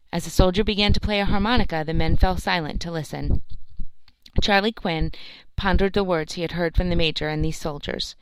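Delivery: usual speaking voice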